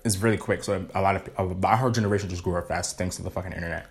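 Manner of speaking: talking while chewing chicken; mouth full. Real, not acted. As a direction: speak through a mouthful of food. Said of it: "Sorry, I'm eating some chicken".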